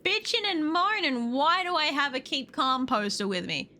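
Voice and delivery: usual speaking voice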